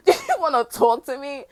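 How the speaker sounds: slow laughing incredulity; laughing mock question. Real, not acted. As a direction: laugh through the mocking question.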